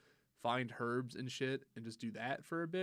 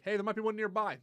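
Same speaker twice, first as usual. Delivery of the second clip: high, bright mock notification voice; chirpy game announcer; 9 semitones higher, 8 dB louder than usual. Mimicking the game's pop-up warning, high chirpy voice. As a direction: voice the game's cheerful alert.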